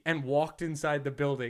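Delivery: ordinary talk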